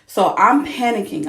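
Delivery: loud, urgent retelling of panic. Real, not acted. Mom telling friends about the C-section scare, loud and urgent.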